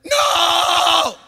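high loud put-on demon yell; mock demon shout. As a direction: defy him as the demon. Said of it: "And the demon said".

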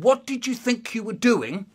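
high, emphatic interrogation; accusing dad. Acted. Dad questioning son about the shaving soap, accusing. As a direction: question him pointedly, phrase by phrase. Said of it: "He said".

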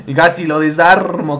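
usual speaking voice

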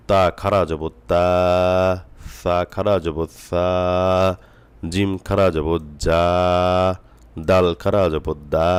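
slow spelling drill, final "তা", "সা", "জা", "দা" held long; drawn-out recitation. Shown as a demonstration. Teacher reading Qaida aloud for learners, slow with long final vowels. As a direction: spell each letter, then stretch "তা", "সা", "জা", "দা". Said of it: "এক আলিফ টানিয়া পড়িতে হয়".

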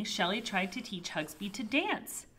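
ordinary delivery, nothing marked

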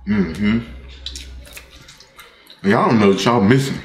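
eating between words; talking while chewing. Real, not acted. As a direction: mouth partly full of food. Seen 3: eats burrito before, licks fingers, talks while still chewing.

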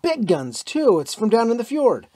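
high, loud and eager; excited shout. A narrator's reading. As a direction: call out over the gunfire. American shouting over gunfire.

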